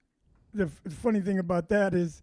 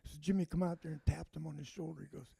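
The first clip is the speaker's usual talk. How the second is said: usual speaking voice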